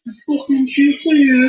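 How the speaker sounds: high, slow Brummie moan; exaggerated Brummie complaint; Birmingham accent. Shown as a demonstration. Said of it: "phrase that seems to come up a lot in Birmingham".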